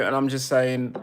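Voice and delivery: ordinary delivery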